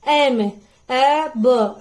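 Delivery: "ए ब" drawn out slowly, sound by sound; slow phonic blend. Shown as a demonstration. Teacher slowly blending "ए ब" for parents to copy. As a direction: stretch "ए ब" very slowly. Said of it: "ये सारे हम लोग add कर रहे हैं ए में".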